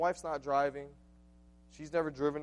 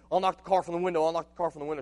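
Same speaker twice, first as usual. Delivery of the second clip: loud, higher urgent call through the window; 5 semitones higher, 9 dB louder, faster than usual. Retold or imitated. Husband calling to wife at the window, urgent and loud. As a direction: yell it so she hears inside.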